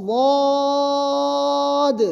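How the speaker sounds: واد held long on a steady tone; long recitation واد; recited letter. Shown as a demonstration. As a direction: chant واد very slowly. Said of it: "جیسے".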